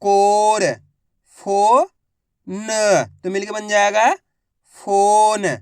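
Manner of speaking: put-on loud, slow syllable-by-syllable reading for children; phonics drill. Shown as a demonstration. Teacher sounding out Hindi words for young students, slow and loud. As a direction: sound out each syllable, then blend the word.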